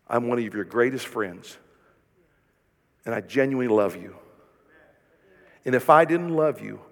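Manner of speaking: low, soft, tender reassurance; soft sincere friend's voice; tender and gentle. Shown as a demonstration. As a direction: comfort a struggling friend softly. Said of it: "you put your arm around her".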